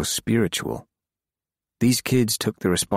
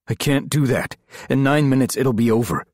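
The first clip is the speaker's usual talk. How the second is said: higher, louder urgent refusal; 8 semitones higher, 4 dB louder than usual. Acted. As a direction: insist over the phone, pushing.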